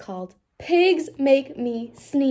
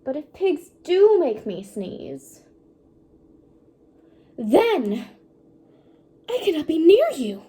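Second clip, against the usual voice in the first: slow lament swelling to a louder cry; building to crying; 7 semitones higher, 8 dB louder, slower than usual. Acted. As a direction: despair building to tears. Gerald's rising despair.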